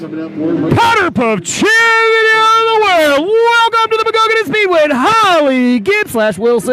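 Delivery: high, slow booming arena announcer. Pretend. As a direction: call it out like a racetrack announcer. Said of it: "let me get my best announcer voice on".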